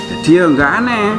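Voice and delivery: usual speaking voice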